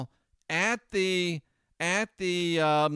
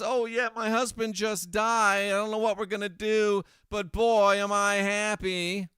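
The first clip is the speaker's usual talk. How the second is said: higher mocking voice, loud on boy; mock fake-sad widow; 7 semitones higher, 4 dB louder than usual. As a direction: lampoon her fake sorrow. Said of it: "And look at this".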